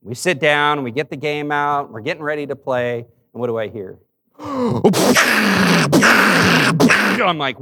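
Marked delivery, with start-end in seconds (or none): 4.4-7.2 loud, high, sharp gasp; a big startled intake of breath. Retold or imitated. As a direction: gasp as if something crashed upstairs. Hearing a thump upstairs.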